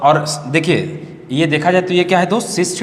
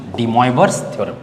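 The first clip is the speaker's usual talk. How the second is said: ordinary talk, nothing marked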